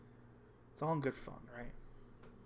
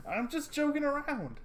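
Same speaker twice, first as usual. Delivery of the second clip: much higher, louder playful voice; high teasing voice; 10 dB louder than usual. Acted. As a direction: brush it off with a grin. Teasing friend laughs it off.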